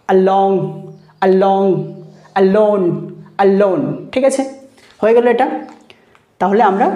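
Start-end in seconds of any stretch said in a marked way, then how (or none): 0.1-1.7 along with a barely touched first "a"; touchy আ. Shown as a demonstration. Teacher demonstrating touchy আ to students, light first syllable in along. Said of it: "তার মানে এটা হলো টাচিয়া". 2.4-3.8 alone with a barely touched first "a"; touchy আ. Shown as a demonstration. Teacher demonstrating touchy আ to students, light first syllable in alone. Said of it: "তার মানে এটা হলো টাচিয়া".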